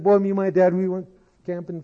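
high-pitched imitation of an eager boy; excited boy. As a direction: gush like a kid telling tales. Boy boasts eagerly to the principal.

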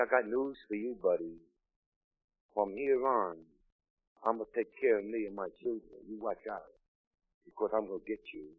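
low, soft retold warning; quiet threat. Wife's retold threat to her husband.

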